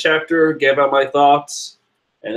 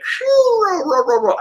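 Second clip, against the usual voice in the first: high squeaky Caesar cackle; Caesar laugh. Retold or imitated. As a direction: pitch the cackle way up. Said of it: "I can't do the Caesar voice".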